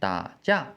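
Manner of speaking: "da" low with no rising tail, "jia" falling; half third tone. Shown as a demonstration. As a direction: say just the falling half of "da", then fourth-tone "jia". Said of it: "just the first half of the third tone".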